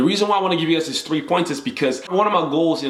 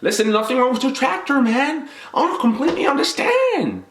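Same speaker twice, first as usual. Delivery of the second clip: hammed-up Southern country twang; country accent; 13 semitones higher than usual. Shown as a demonstration. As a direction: play a friendly country guy, voice pitched way up. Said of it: "into a rather country crowd and be able to speak like".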